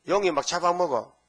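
ordinary delivery

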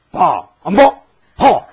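loud, harsh commands; bossy barking. Shown as a demonstration. Preacher shows the wrong way to evangelize, loud and bossy. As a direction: bark it at them like a pushy stranger. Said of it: "이렇게 하면 안 봐".

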